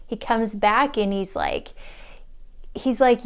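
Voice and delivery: usual speaking voice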